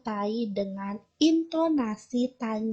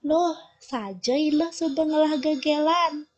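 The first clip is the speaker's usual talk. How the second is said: "Loh, saja ilah subengelah gegelan" pitched higher with a questioning lift; question intonation; 5 semitones higher, 4 dB louder than usual. Shown as a demonstration. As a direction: ask it as a real surprised question.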